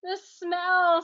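high strained cry; anguished. Acted. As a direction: gasp it out in distress. Boy falling to his knees.

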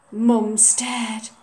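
usual speaking voice

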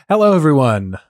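loud, bright streamer hello; intro greeting. Acted. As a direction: open the show with a big hello. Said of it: "final take of the intro greeting".

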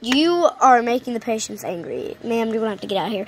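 low, bored doctor brushing off his patient; gruff doctor voice. Acted. Doctor telling a pregnant patient to step on the scale, low and bored. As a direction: drop low and play the unbothered vet.

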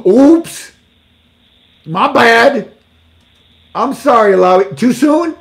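teasing the resigned minister, high and loud; mock apology. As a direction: fake oops, teasing.

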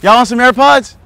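high, loud showy holler; loud mock bragging. Joker playing too rich to hold things, loud mock bragging. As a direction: project it cheekily across a distance.

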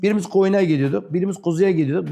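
ordinary talk

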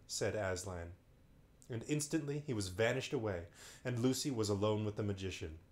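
ordinary delivery

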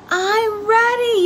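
a small frog bursting to go, yelling it out; eager little frog yell. A narrator's reading. Froggy shouting back to his father, high eager yell. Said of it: "yelled Froggy".

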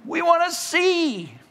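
high-pitched, strained plea; pleading cry. Acted. Preacher as blind men answering Jesus, high desperate cry. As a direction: cry it out high, begging for sight.

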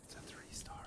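hushed whisper so the deer won't bolt. Real, not acted. Hunter whispering to his partner about a deer. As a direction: breathe it out unvoiced.

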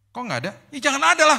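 high, loud and laughing; laughing banter. Retold or imitated. Doctor puzzled and patient teasing back, laughing loud. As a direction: toss it back while cracking up.

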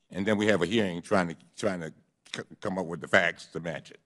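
ordinary delivery

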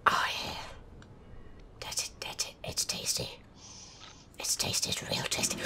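very soft breathy whisper. Real, not acted. Gamer savouring careful progress, hushed whisper.

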